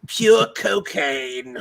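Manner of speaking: loud, high, drawn-out mock Australian narrator; bad Australian accent. Revealing a drug find on the show, bad Australian accent. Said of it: "Today on Accents Done Poorly".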